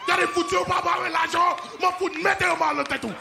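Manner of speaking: loud, high Creole threat; mock-furious Haitian cursing out a tenant. Haitian landlord threatens a tenant in Creole. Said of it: "You say that shit in Creole".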